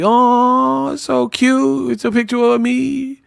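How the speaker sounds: high, drawn-out mock gushing; fake happy. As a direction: coo as if delighted with an awful gift. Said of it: "tried to front like she was happy".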